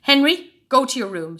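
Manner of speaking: loud, stern order; a furious mother ordering her son out; angry mum shout. A narrator's reading. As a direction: send him to his room loudly. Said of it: "shouted Mum".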